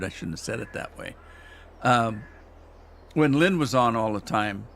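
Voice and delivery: ordinary talk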